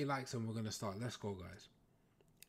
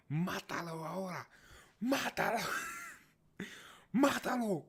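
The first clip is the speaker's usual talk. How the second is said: hammed-up gangster order, higher and much louder; Narcos gangster quote; 11 semitones higher, 7 dB louder than usual. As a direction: bark it like a TV cartel boss. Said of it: "This is from Narcos".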